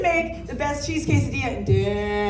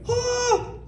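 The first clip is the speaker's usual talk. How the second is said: high, startled Oh; a sharp, high yelp of shock; shocked gasp; 12 semitones higher than usual. Retold or imitated. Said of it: "this look of shock".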